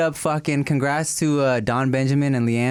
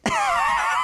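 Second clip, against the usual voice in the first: burst of laughter. Real, not acted. Toddler cussed, dad cracks up.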